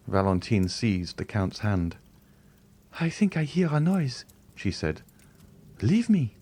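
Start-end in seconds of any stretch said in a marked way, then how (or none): 3.0-4.3 put-on high, pleading young woman's voice; a terrified girl refusing to believe; frightened young girl. A frightened young Valentine begging the Count to leave her. 5.8-6.4 put-on high, pleading young woman's voice; a terrified girl refusing to believe; frightened young girl. A frightened young Valentine begging the Count to leave her.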